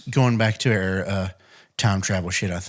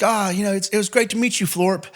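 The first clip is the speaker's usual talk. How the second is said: higher-pitched mock goodbye to an alien; kids' fond goodbye; 9 semitones higher than usual. Retelling the kids' farewell to their alien friend, high mock goodbye. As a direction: voice the kids, pitched well up.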